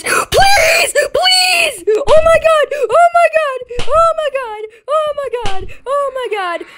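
high crying shouts of please and oh my god, fading; panicked begging. Real, not acted. Player caught by the teacher, high panicked wailing. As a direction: beg and wail as if caught.

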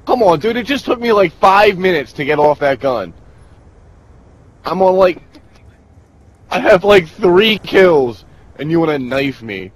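high, loud whiny rant; raging gamer. Real, not acted. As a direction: complain loudly, pitch up high. Player knifed after long effort.

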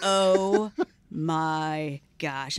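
drawn out very slowly; dawning horror. Retold or imitated. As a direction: stretch each word in stunned realization. Retold slow horrified realization.

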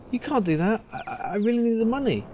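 fired barman begging his boss; desperate pleading. Acted. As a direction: beg for the job in a panic.

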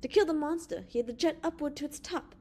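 mildly worked-up insistence; heated. A narrator's reading. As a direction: pitch lifted in heated argument. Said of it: "demanded Evans with heat".

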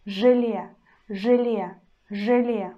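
Желе said with hard ж, э not е; hard ж demo. Shown as a demonstration. Teacher repeats Желе for students, hard ж. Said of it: "we still pronounce in a hard way".

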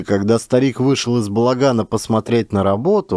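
ordinary delivery, nothing marked

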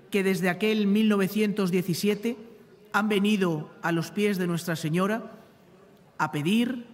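normal talking voice

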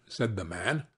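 ordinary delivery

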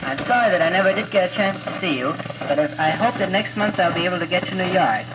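ordinary delivery

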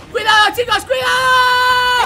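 loud drawn-out warning cry; shouted warning. Real, not acted. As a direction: yell a slow urgent warning. Warning teammates floor falls.

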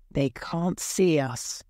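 ordinary delivery, nothing marked

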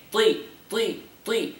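"Ti" said light and thin, with a fronted i; light kasra ta. Shown as a demonstration. Teacher showing a student the thin ti. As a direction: repeat "ti" softly and lightly. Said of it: "So how we pronounce the ta".

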